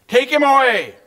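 high, loud narrated outcry; crowd's shout. A narrator's reading. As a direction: shout it out as one angry crowd. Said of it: "They all shouted together with one voice".

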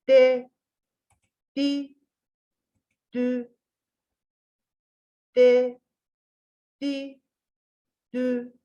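"De, di, dü" with tongue out, lisped; tongue between the teeth for "De, di, dü". Shown as a demonstration. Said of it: "Dilimiz dışarıda, peltek". (dil dışarıda peltek "De, di, dü")

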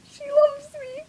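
high-pitched, emotional squeak; overwhelmed with affection; tearfully moved. Real, not acted. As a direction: voice climbing high, near tears with love. Moved by the pet's love.

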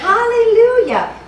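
high, loud cry of praise; exultant praise. Real, not acted. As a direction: ring out the hallelujah. Preacher cries praise.

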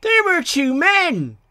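high, slow, emphatic protest; high-pitched, drawn-out indignation; indignant squeak. A narrator's reading. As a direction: insist in squeaky little-engine voices. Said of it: "squeaked Bill and Ben indignantly".

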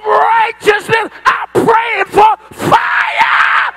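shouting, high-pitched preacher crying out for fire; fiery preaching. Acted. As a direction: preach it full force, calling down fire.

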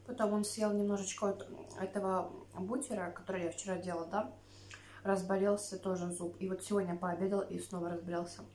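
eating seaweed between words; talking while chewing. Real, not acted. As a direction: mouth partly full of food. Seen 3: Picks seaweed with chopsticks, mouth moving, bites it near end.

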